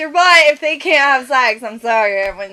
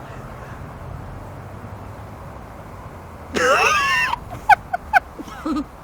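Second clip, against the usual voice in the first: high choking gasps and coughs; coughing on dry powder; 5 dB quieter than usual. Real, not acted. Choking on cinnamon.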